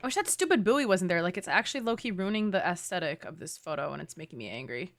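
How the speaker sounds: usual speaking voice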